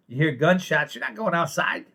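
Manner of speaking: usual speaking voice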